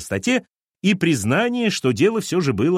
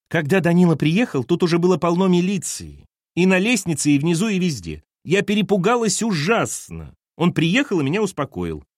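Lena's voice; 7 semitones higher, 3 dB louder than usual. A narrator's reading. Lena voiced in the story.

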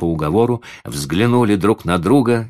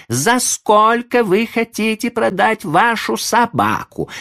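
high, loud, drawn-out demand; exasperated lady; 4 dB louder than usual. A narrator's reading. Lady repeating her demand to the old man, exasperated. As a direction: spell it out slowly and angrily, high-pitched. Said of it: "вскипела дама".

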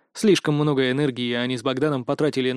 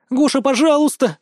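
high-pitched, whimpering plea; sobbing plea; 10 semitones higher, 4 dB louder than usual. A narrator's reading. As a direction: beg as if through sobs. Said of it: "всхлипнула Людмила".

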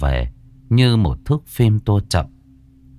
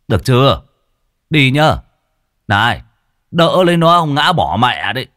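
grumbling neighbour; 3 semitones higher than usual. Acted. Neighbour on the motorbike warns husband to hold her, grumbling. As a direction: call out instructions, then stretch out the complaint.

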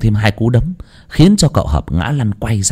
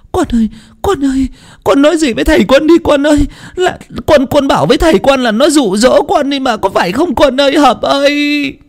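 much higher, desperate pleading mother; tearful mother. A narrator's reading. Mother begging her son to confess, desperate tearful pleading. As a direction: urge him through tears, high and frantic.

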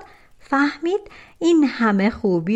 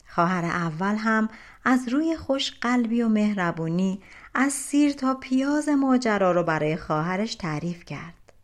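ordinary delivery

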